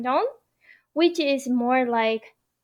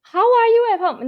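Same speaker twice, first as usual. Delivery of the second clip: wrong wording tried; 10 semitones higher, 6 dB louder, faster than usual. Shown as a demonstration. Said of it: "I don't think it's not how".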